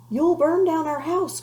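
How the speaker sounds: mother crying out as flames spread; alarmed cry. A narrator's reading. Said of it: "cried his mother".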